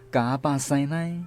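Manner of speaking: normal talking voice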